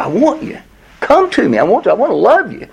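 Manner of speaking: high, yearning plea as God; longing voice of God calling his people home; tender plea. Acted. God pleading tenderly with Israel.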